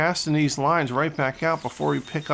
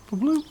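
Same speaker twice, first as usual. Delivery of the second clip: mouth splash sound; 11 semitones higher than usual. Retold or imitated. Imitating lure hitting water.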